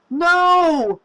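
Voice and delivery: piercing high scream of denial. A narrator's reading. Woman screaming as the door seals her friend away, shrill scream. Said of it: "she screamed and hurled herself".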